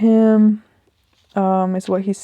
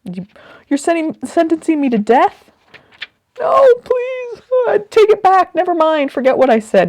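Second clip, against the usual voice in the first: mock panicked pleading, much higher and louder; panicked begging; 11 semitones higher, 7 dB louder than usual. Imagining herself sentenced to death, hammed-up frantic begging. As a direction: beg to take the sentence back, voice jumping up high.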